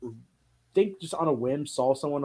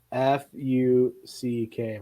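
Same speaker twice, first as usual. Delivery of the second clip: slow spelling; much slower than usual. Retold or imitated. Kid spelling out word.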